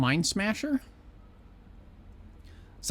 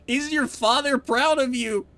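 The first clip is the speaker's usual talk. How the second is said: exasperated, louder, higher taunt; heated insult; 6 semitones higher, 5 dB louder than usual. Acted. Rude character mocking the newcomer's smell and father, heated taunting. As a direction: pile on the insults, rising in pitch and volume. Escalating insults read in game.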